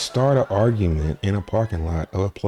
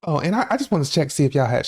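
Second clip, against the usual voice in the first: fast, much higher, jittery rush; talking all fast; 10 semitones higher, faster than usual. Retold or imitated. Coked-up woman rushing her excuse, fast and high. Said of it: "she's talking all fast".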